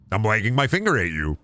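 ordinary delivery

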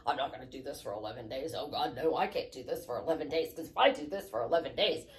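low, soft, rapid monotone grumbling; reluctant protest. Acted. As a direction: rattle off a dreary refusal under your voice. Acting out a reluctant listener's protest.

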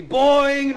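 loud, high sustained spring noise; cartoon boing. Comedian as Zebedee bouncing in, loud spring noise. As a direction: belt out a long, high boing.

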